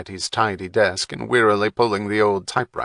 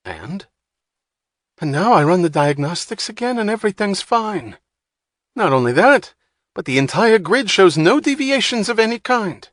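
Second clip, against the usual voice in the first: much higher, louder complaint; exasperated rant; 11 semitones higher, 5 dB louder than usual. A narrator's reading. Wapner complaining to Hatch, exasperated higher voice. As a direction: push the absurdity.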